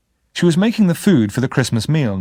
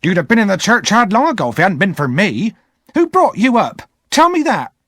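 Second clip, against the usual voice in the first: shrill, loud, high angry woman; scolding older sister; 10 semitones higher, 4 dB louder than usual. Acted. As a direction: berate the boy, pitched up high. Missus Joe scolding Pip.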